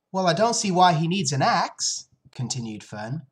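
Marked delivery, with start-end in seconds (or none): 0.1-2.1 put-on higher, louder questioning child; lightly voiced puzzled little girl; eight-year-old girl. Eight-year-old Fern questions her mother, higher and louder. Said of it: "who was only eight".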